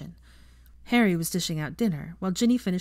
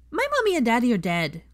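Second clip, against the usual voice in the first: put-on serious child; 6 semitones higher, 6 dB louder than usual. A narrator's reading. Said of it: "Teddy told her seriously".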